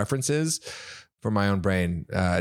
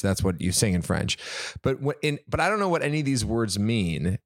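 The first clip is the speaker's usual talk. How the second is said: ordinary delivery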